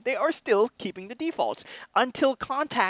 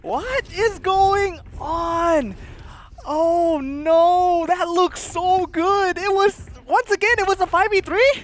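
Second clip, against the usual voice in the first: shocked exclamation; 8 semitones higher, 4 dB louder, slower than usual. Real, not acted. Caster shocked by comeback.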